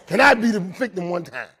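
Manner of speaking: ordinary talk, nothing marked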